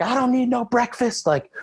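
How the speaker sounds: high, mock-furious rant; screaming and yelling. Lifter yelling at his coach in the gym, high and ranting. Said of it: "screaming and yelling".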